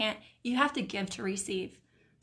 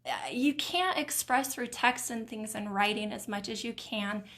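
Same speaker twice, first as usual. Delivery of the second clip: ordinary delivery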